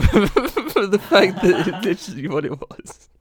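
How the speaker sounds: cracking up while talking, pitch climbing; real helpless laughter. Real, not acted. Co-host caught out over his complaining, laughing helplessly. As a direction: talk through a real laughing fit.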